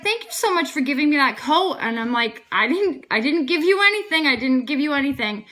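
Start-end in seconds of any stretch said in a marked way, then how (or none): 0.0-1.8 higher, louder retold gratitude; gushing thanks. Coat recipient thanking the giver, mildly gushing. 2.5-5.4 higher, louder retold protest; emphatic denial. Giver refusing credit for the coat, emphatic denial.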